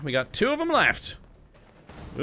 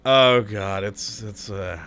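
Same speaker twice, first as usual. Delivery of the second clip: ordinary delivery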